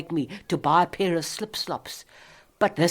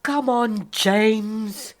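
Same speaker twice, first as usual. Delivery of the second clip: louder, higher call; 7 semitones higher, 5 dB louder, much slower than usual. Real, not acted. Woman calls out impatiently for James.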